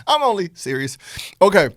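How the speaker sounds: laughing loudly through the words. Real, not acted. Laughing off his joke to the viewers. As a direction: crack up and half-joke you're serious.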